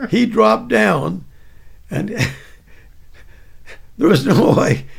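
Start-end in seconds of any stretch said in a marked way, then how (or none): none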